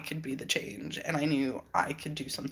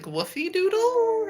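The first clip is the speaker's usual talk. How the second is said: much higher, slow questioning mimic; puzzled man quoted; 6 dB louder, slower than usual. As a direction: stretch it out like a confused stranger. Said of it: "He's like".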